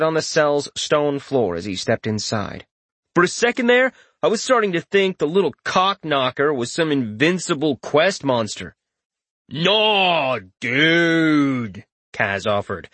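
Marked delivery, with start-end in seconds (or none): 3.1-8.7 smirking. Acted. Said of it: "the smirk loud in his tone". 9.5-11.8 drawn-out mock surfer drawl; imitating the hero. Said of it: "drawing out the words ... imitate the hero's speech".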